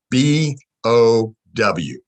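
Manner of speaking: ordinary talk, nothing marked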